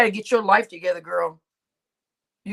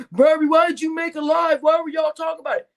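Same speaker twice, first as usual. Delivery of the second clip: mock accuser; 5 semitones higher, 4 dB louder than usual. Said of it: "don't come at me saying".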